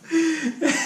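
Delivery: real burst of high laughter; high bursting laugh at the memory. Real, not acted. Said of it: "it was so funny".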